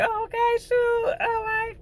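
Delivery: very high, drawn-out squeal of approval; high-pitched, playful "well, all right" reaction; intrigued approval. Retold or imitated. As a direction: stretch it out way up high, amused and impressed. Her amused reaction to male strippers.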